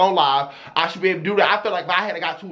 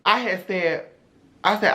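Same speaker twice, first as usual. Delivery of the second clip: usual speaking voice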